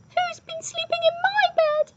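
very high, loud little squeak; squeaky baby bear. A narrator's reading. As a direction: squeak it like a tiny bear cub. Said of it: "squeaked Baby Bear".

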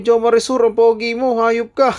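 high, loud squealing fan voice; mock fan shout. Vlogger imitating fans calling out his name, high and loud. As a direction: yell it like a crowd of admirers.

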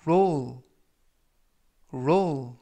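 teacher models roll for learners to repeat; model pronunciation. Shown as a demonstration. Teacher demonstrates the word roll for students, careful modeling. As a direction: roll said slowly and clearly with a falling tune. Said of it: "이라고 발음하시면 되겠고요".

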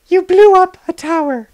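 high-pitched woman's voice; high female voice. Acted. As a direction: play her high and light. Elena voiced.

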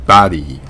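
バディ with an open 'ah' for the o; English 'body' as 'bah-dy'; American pronunciation. Shown as a demonstration. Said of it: "あに近い発音ですよね".

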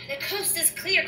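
lightly shouted all-clear; mild shout. A narrator's reading. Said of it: "Cornelia shouted".

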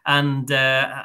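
ordinary talk

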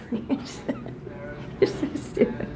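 breathless laughing. Real, not acted. Laughing teasing between friends.

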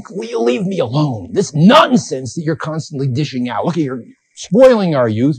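acted indignant brush-off, not shouted; irritated rebuff. Callicles rebuffs Socrates, acted quarrel.

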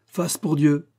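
a loud, high cry; shouting. A narrator's reading. Taillefer tosses a coin, shouting. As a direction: call it out as the coin flies. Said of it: "en criant".

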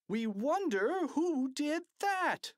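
high, drawn-out crowd call; fans' shout. A narrator's reading. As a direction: chant the question slowly and high. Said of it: "shouted the fans in the bleachers".

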